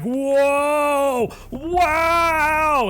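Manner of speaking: very high drawn-out wow of wonder; amazed. Acted. Boy amazed at growing tall, high wow. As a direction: marvel at the new body. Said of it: "he's like".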